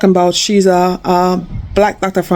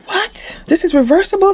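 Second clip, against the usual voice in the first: pitched way up in disbelief; finding out it can be reversed, high astonished cry; 11 semitones higher than usual. Retold or imitated. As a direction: gasp it out, amazed. Discovering diabetes is reversible.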